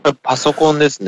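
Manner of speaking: real crackly digital connection; walkie-talkie sound. Real, not acted. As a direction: talk as if through a cheap transceiver. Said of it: "トランシーバーみたい".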